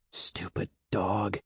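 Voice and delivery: very soft, slow rebuke. A narrator's reading. Evan holding the dog's jaws, softly scolding. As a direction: murmur it to the dog, worried. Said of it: "Evan said softly".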